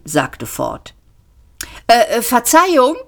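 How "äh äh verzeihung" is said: raised, higher voice to get attention; stammer, then call out politely; hesitant call. A narrator's reading. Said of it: "rief er".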